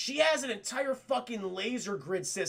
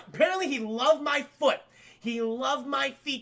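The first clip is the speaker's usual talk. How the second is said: ordinary talk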